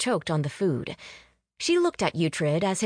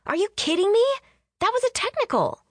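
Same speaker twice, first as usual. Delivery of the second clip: very high, indignant yell; 3 dB louder, faster than usual. A narrator's reading. Fan yelling at the TV over a foul call. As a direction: shout your disbelief at the referee's call. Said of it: "yelled at the T V".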